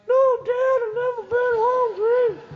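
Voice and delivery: put-on very high, small-boy voice; squeaky kid. Shrunken son answering his dad in a very high little voice.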